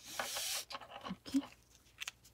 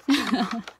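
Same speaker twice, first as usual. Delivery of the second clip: short, light chuckle; amused chuckle. Real, not acted. Host sees the fit is not perfect, amused chuckle. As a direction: chuckle briefly to yourself.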